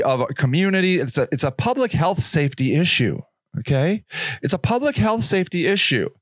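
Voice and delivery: low, slick press-statement voice; mock PR official. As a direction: spin it like a police spokesman. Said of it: "They're like".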